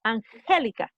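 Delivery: Angélica with the weight on the second syllable, GÉ; stress on GÉ. Shown as a demonstration. Teacher showing her name's stressed syllable, Angélica stressed on GÉ. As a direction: lean on the GÉ in Angélica. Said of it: "El mayor énfasis de mi nombre está en la segunda sílaba".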